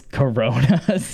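"Coronas" drawn out in mimicry; imitated pronunciation. Fan recalls Bobby Newport's intro, "Coronas" said in imitation. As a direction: copy his way of saying "Coronas", slowly.

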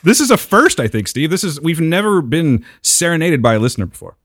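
ordinary delivery

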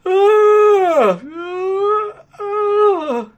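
long, very high cartoonish wails; mock wailing. Reviewer reacting to all the film's killing, mock wail. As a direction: wail loudly and high, three drawn-out cries.

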